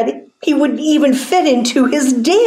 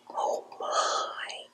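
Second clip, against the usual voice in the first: hushed, breathed amazement; very soft whispered aside; whispered reaction; 18 dB quieter than usual. Pretend. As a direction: whisper it in mock wonder.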